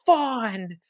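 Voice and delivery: high, drawn-out battle cry; mock screaming. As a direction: holler it like a hammy rallying cry. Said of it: "he just starts screaming out of nowhere".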